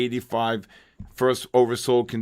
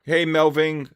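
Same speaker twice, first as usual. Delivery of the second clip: usual speaking voice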